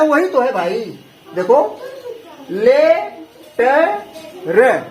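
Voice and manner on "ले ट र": "ले ट र" as three separate syllables with long pauses; अक्षर-अक्षर पढ़ना. Shown as a demonstration. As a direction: sound out "ले ट र" one piece at a time. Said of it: "मैं अलग से कुछ पढ़ रहा हूँ". ("ले ट र" अलग-अलग अक्षर, रुक-रुक कर)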